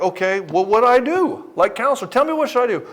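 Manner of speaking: pleading client. Retold or imitated. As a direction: beg the counselor for an answer.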